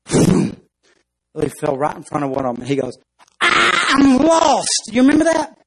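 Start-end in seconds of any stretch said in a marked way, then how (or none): 3.4-4.9 high, loud panicked cry; panicked ant. Acted. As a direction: shriek it like an ant gone to pieces. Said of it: "he completely freaks out".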